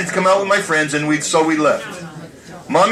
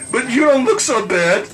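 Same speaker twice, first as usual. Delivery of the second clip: teasing mother; 7 semitones higher than usual. Retold or imitated. Mother teasing her son.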